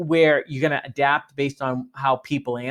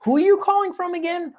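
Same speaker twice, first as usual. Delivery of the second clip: confused gatekeeper; faster than usual. Retold or imitated. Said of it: "Or the second one".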